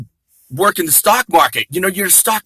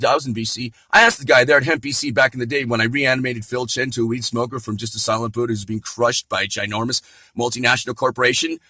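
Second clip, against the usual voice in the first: normal talking voice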